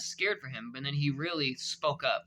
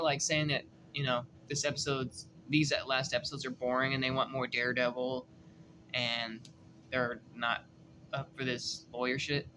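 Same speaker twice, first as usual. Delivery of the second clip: ordinary talk, nothing marked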